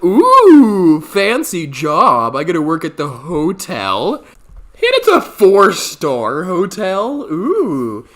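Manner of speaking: high, loud, slowed ooh of delight; mock impressed. Narrator finding the hotel job, mock-impressed gushing. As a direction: gush over the fancy job. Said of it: "I was like".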